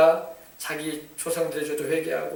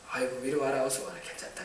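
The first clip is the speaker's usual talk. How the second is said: soft, lower, whispered welcome; tender parent; 6 semitones lower, 13 dB quieter than usual. Retold or imitated. A parent welcoming a repentant child, softly. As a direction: coax the child in gently.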